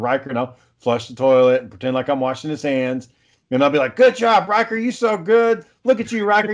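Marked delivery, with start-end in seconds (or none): 4.0-6.5 put-on high, sing-song praise for a toddler; gushing praise. Dad praising little brother to tempt his son, high gushing voice. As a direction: lay on the praise, pitched right up.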